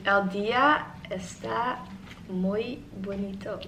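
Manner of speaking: slow, careful learner's Spanish. Shown as a demonstration. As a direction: sound out each word slowly. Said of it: "There you go".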